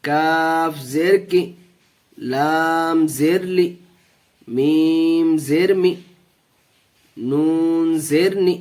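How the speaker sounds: steady, measured letter-by-letter recitation; spelling drill. Shown as a demonstration. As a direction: chant each syllable like a teacher leading the class.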